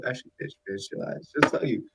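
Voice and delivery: ordinary talk, nothing marked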